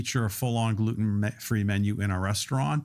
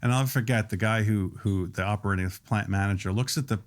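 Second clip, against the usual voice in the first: usual speaking voice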